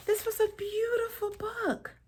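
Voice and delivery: high, soft and delighted; light, high, fond comment; bright high praise. Real, not acted. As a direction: gush sweetly about the book. Reader reviews book afterward.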